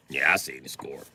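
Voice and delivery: a hint of a glum buddy, low and slow; lightly voiced grumbling friend. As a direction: drop low and drag it out, fed up. Friend answering on the phone.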